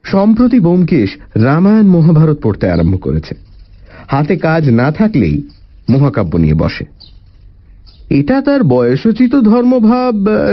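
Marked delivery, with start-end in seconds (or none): none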